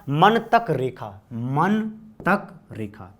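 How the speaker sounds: slow, drawn-out repetition; slow repeated mnemonic. Shown as a demonstration. Teacher drilling the class on the mnemonic, slowly. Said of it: "क्या?".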